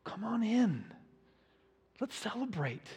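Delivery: soft, tender invitation; a father gently pleading with his son; gentle coaxing father. Retold or imitated. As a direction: coax him in gently. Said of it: "and says".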